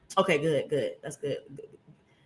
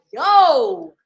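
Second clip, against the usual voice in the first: sharp yo, higher and louder; startled yo; 7 semitones higher, 8 dB louder than usual. Retold or imitated. Higher, louder shocked yo at the dancing man. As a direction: snap it out as he drags you. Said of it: "I'm like".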